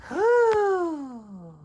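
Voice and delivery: high breathy sigh of relief; relieved whoo. Acted. Worm relieved the bird left, high whoo.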